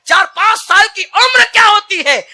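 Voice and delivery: high, loud cry on उम्र; roaring repeat. Shown as a demonstration. Preacher asking the congregation, crying out high and loud. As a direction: hurl the question at the crowd. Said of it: "repeated louder".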